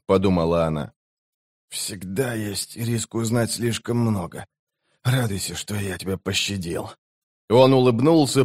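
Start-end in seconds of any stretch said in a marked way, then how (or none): 1.7-6.9 hushed voice of a wounded mage; dying mage. A narrator's reading. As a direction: warn her softly, strength ebbing away. Dying mage warns sorceress. (тихий, слабеющий голос умирающего)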